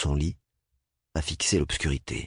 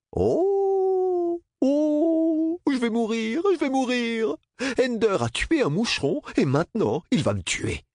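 high, hammed-up fake panic; mock terror; slower than usual. A narrator's reading. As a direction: shriek in mock fear.

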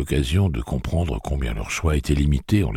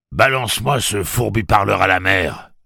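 loud, higher-pitched command; pirate's order; 6 semitones higher, 7 dB louder than usual. A narrator's reading. Pirate ordering the boy to dump the animal, loud command. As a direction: order it forcefully.

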